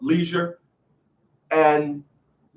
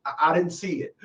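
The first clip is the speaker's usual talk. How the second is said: soft, stammered mock excuse; feeble excuse; 2 semitones lower, 8 dB quieter than usual. Man caught out by his partner, mock sheepish. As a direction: back down quietly, as if caught out.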